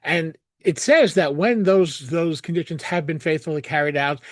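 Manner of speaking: normal talking voice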